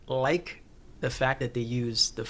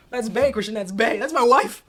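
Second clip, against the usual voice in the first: excited; 9 semitones higher, 8 dB louder than usual. Real, not acted. Gushing over favorite character.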